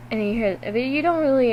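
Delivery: ordinary talk, nothing marked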